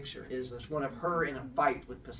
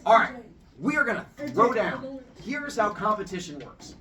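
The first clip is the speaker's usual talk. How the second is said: higher, louder contest announcement; acted challenge; 7 semitones higher, 6 dB louder than usual. Storyteller as Athena announcing the weaving contest, acted challenge. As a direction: lay down the challenge to a rival.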